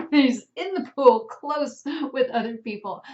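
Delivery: amused, laughing aside. Real, not acted. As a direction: chuckle while pointing it out. Laughing over pre-covid class photos.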